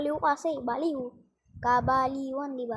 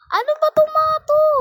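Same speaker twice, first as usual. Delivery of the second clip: much higher, much louder angry yell; exasperated shout; 12 semitones higher, 12 dB louder than usual. Real, not acted. Gamer yelling at teammates who won't pass, furious and high. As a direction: blow up at useless teammates. Annoyed at teammates not passing.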